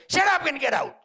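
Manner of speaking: high, forceful dismissal; acted angry yell. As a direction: imitate a boy yelling at his dog. Dejected boy yells at the puppy.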